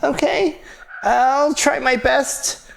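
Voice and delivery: high, slow, small voice; timid little mage. Acted. Young mage agrees to help, timid high voice.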